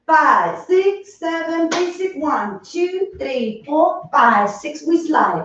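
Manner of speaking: counting the beat, steady and sung-through; rhythmic dance counting. Acted. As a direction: chant the counts in time with the steps. Instructor counting dance steps.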